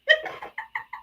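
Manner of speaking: high breathy laugh. Real, not acted. After his theatrical shout.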